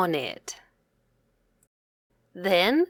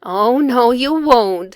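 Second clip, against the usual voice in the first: louder, amused scolding; laughing mom; 3 semitones higher, 7 dB louder than usual. A narrator's reading. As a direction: tease back with a laugh. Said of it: "said Mom, laughing".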